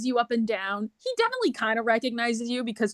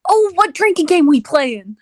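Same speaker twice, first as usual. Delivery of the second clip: loud, higher-pitched character voice; eager drinking buddy; 4 semitones higher, 10 dB louder than usual. Acted. Chippendale asks about the drinking game, loud and eager. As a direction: jump in eagerly, loudly.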